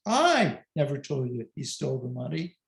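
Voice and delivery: long, punched "I" at the start; stress on I. Shown as a demonstration. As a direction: hit the first word "I" hard. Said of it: "emphasize a different word".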